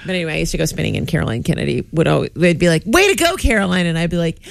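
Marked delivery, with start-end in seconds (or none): 2.9-3.9 cheering. Retold or imitated. Class cheering Caroline Kennedy.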